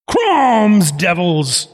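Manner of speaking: hammed-up battle oath; acted yell. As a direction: cry out like a comic-book barbarian. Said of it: "yells out".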